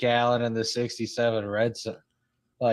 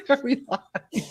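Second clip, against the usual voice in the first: laughing; slower than usual. Pretend. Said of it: "I'd be like".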